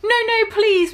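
pleading beg. Acted. Friends begging the villain with the knife, mock pleading. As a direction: beg him to stop, hammed-up. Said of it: "we begged sadly".

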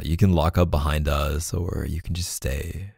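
ordinary delivery

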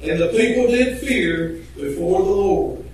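low, slow solemn reading of scripture. Acted. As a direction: read the verse softly, weighing each word. Reads scripture aloud.

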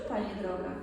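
normal talking voice

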